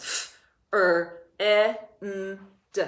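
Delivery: "F, er, eh, n, d" sound by sound. Shown as a demonstration. Teacher breaking one syllable into sounds, sounding out. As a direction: separate each phonics sound in "F, er, eh, n, d". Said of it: "All one syllable".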